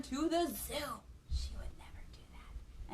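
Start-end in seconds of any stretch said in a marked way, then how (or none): 1.3-2.9 unvoiced reassuring whisper. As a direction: whisper it like a secret. Reassuring aside to children.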